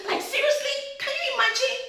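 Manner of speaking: high disbelieving rise; can't believe what she hears; incredulous. Retold or imitated. Wife reacting to husband's postponed birthday, high disbelief.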